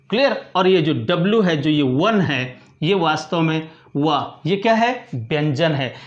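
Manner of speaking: ordinary talk, nothing marked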